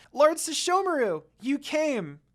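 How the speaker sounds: high, bright-loud cry of relief; a happy child seeing her rescuer arrive; happy girl. A narrator's reading. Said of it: "Rin said happily".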